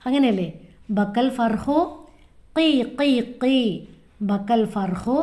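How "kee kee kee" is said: high little chick cheeps, kee kee kee; chick cheeping, a little higher. Shown as a demonstration. Teacher showing children a chick's cry, high cheeps. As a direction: chirp like a baby bird. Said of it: "കിളിക്കുഞ്ഞുങ്ങൾ എങ്ങനെ കരയാറ്?".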